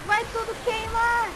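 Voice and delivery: alarmed cry. Real, not acted. Fire spreading in fight.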